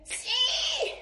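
put-on high, eager teenage yelp; teen yelling yes. Teen son answering dad about eating, eager yelp. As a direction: shout it like a thrilled teenager. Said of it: "Grita el adolescente".

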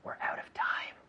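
unvoiced whisper. Shown as a demonstration. Teacher whispering to his student that time is up. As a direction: breathe it without voice. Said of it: "whispered sign-off".

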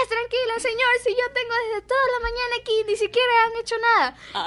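high, exasperated protest; mock mother refusing to calm down; mother upset at the inspector, mildly mocked; imitating an indignant mother.

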